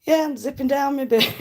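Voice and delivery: hammed-up sex-line operator voice; cozy mock seductive voice. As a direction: ham up a phone-line tease. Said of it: "and going".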